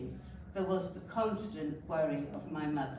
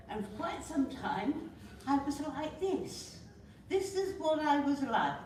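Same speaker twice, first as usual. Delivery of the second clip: higher, tense and a little halting; anxious, on edge; 8 semitones higher than usual. Shown as a demonstration. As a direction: show how wound-up and anxious she was back then. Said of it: "I'm actually comparatively calm at the moment".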